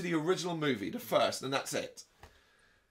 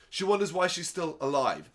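normal talking voice

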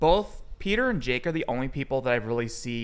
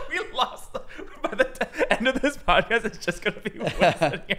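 high giggling speech; laughing; 7 semitones higher than usual. Real, not acted. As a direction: talk through real laughter. Bursts out laughing after Kevin leaves.

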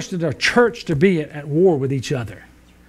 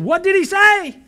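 high, loud blurt from a hard-of-hearing wife; 13 semitones higher, 4 dB louder than usual. Acted. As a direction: blurt it out much higher and louder. Wife voiced in joke.

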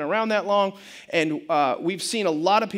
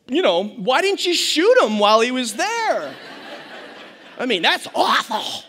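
laughing through a sarcastic joke; laughing at the absurd idea; laughing sarcasm; 3 semitones higher, 4 dB louder than usual. Real, not acted. Sarcastic remark about teacher, laughing.

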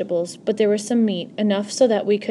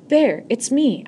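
high, raised plea to her brother; crying out; 5 semitones higher, 4 dB louder, slower than usual. Acted. Sister cries out to her frightened little brother. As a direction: call out to reassure him. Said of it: "I cried".

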